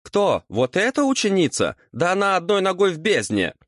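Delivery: very high, incredulous outrage; devil's high-pitched disbelieving protest; indignant. A narrator's reading. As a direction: scoff in a high, affronted voice. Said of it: "возмутился чёрт".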